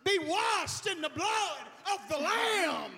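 high, loud mock preacher shout; hammed-up shouting, pitched high. Shown as a demonstration. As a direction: belt it out to force understanding. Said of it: "let me just say it louder".

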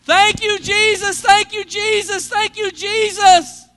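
high, loud repeated shout of praise; oblivious, drawn-out praise yelling. Acted. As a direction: shout thanks with no care who hears. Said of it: "He was just louder than the normal person".

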